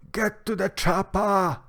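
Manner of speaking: high, loud action-hero yell; hammed-up action movie shout; Arnold Schwarzenegger. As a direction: shout it like an action star ordering an urgent escape. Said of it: "said that in Arnold Schwarzenegger's voice".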